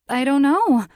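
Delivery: high, loud flustered denial; nervous denial. Acted. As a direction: blurt the lie nervously. Guilty stammered denial.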